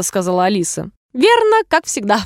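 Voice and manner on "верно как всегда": higher-pitched delighted agreement; exclaiming. A narrator's reading. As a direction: exclaim it in eager approval. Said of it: "воскликнула герцогиня". (восторженное восклицание)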